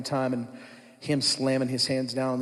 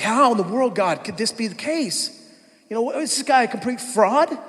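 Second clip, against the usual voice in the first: higher, louder outraged plea to God; exasperated; 7 semitones higher, 4 dB louder than usual. Retold or imitated. Angry pastor asking God about the fraud, higher and louder outrage. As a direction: cry out at God in disbelief. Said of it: "I was so mad".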